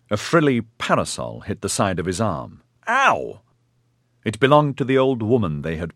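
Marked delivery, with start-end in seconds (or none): 2.9-3.4 high yelp; sharp ow of pain. A narrator's reading. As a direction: yelp as the parasol hits. Hit by a parasol.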